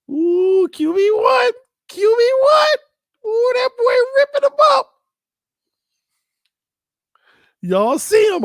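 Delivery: high, loud whooping hype; hype excitement. Real, not acted. Vlogger hypes his quarterback's workout video to viewers, whooping high and loud. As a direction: gush over the quarterback like a fan. Hyping the highlight clip.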